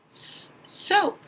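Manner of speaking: teacher sounding out soap; stretched first sound. Shown as a demonstration. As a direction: hiss then say "soap" slowly. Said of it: "first sound you hear".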